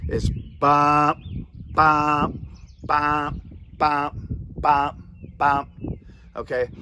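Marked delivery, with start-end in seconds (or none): 0.1-5.7 rhythmic bump-bump call cadence; duck call honks. Shown as a demonstration. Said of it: "If I can do it ten times in my exercise".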